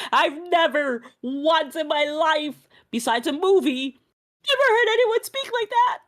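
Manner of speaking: emphatic protest climbing to a high squeal; insist, indignation rising as you go; offended. Real, not acted. Canadian offended by friend's accent claim, rising indignant protest. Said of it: "I'm offended".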